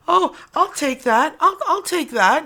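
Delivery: high, loud mock-eager voice; eager grabby taker. People with their hand out to a giver, mock-eager. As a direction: pipe up like a shameless taker.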